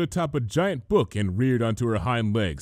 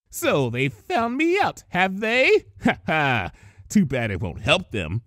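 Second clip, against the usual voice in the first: high, slow gloating villainess; 4 semitones higher than usual. Acted. Villain gloating over her captives, high and smug. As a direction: savour the gloat like a storybook villain. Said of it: "she gloated".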